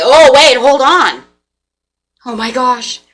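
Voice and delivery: high, loud mock gasp of alarm; startled imitation. Partner panics, acted quote.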